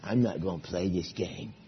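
low, soft sulking refusal; sulky kid. Retold or imitated. Kid at camp sulking on the sidelines, low and soft. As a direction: pout it out like a kid who won't play. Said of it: "kids off to the side saying".

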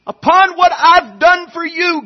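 slow, loud, high pleading plea; the Lord imploring his people, slow and loud; God pleading. Acted. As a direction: beg as God would, drawn out. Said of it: "God says".

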